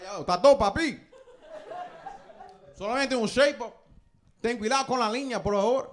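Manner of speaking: put-on faking a Dominican accent to pass; Spanglish Dominican. Ordering a haircut from the barber in Dominican Spanish. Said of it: "I'm like".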